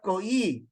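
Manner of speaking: koi broken into two syllables, ko-ee; wrong reading. Shown as a demonstration. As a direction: stretch koi into ko, then ee. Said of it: "It's not koi".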